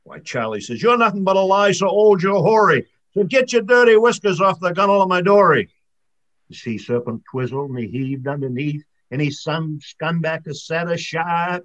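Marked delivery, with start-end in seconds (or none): 0.8-5.7 loud, higher scornful retort; a loud scornful fisherman's taunt; defiant insult. Acted. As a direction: tell the monster off. Charlie insulting the serpent.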